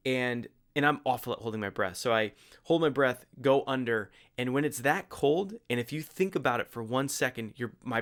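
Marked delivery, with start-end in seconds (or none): none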